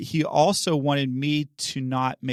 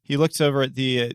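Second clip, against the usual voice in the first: ordinary delivery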